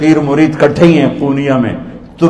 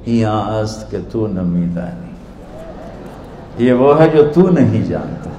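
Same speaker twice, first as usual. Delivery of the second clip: innocent; 5 semitones lower, 6 dB quieter than usual. Retold or imitated. Dervish returning the scholar's own words, softly innocent. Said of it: "بڑی معصومیت سے بولے".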